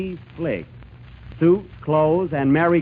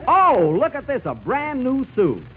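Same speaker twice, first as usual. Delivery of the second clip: delighted; 8 semitones higher than usual. Acted. Delighted over gift suit.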